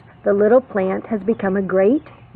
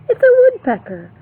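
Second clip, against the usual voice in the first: high, loud and fast announcement; excited reveal; 14 semitones higher, 6 dB louder, faster than usual. Acted. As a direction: burst out with the discovery.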